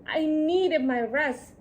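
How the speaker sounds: ordinary delivery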